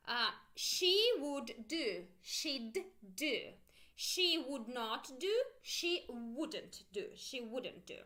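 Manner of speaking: slow "she would do, she'd do" and negatives; slow drill. Shown as a demonstration. Teacher slowly drilling she forms. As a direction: model each form for students to copy. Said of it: "Make sure you pronounce with me".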